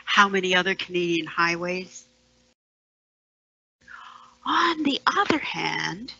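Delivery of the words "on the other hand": whispered, unvoiced, with a rising end; whispered aside. Speaker confiding a darker fate in a whisper. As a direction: drop to a secret whisper. Same phrase taken as a whisper.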